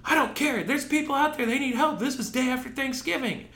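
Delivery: high, pinched voice of a stern boss; stern manager. Retold or imitated. Manager refusing hungry worker his lunch, dismissive. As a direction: mimic the boss brushing you off. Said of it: "And he goes".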